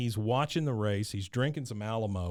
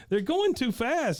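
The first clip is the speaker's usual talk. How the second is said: put-on whiny boy voice; 12 semitones higher than usual. Bobby voiced.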